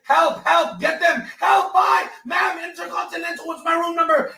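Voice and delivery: high, loud frantic screams for help; panicked screaming. Acted. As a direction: scream as if a gun is at your head. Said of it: "That's what I have to do to get help?".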